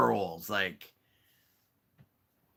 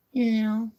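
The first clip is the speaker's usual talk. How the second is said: high pet talk; 9 semitones higher, 5 dB louder than usual. Real, not acted. Owner coaxing her cat to go down in high baby talk. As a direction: coo each word up at the cat.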